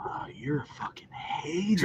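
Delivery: whispered jab at his friend; teasing whisper. Real, not acted. Friend whispers a teasing jab at him. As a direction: hiss it as a joke.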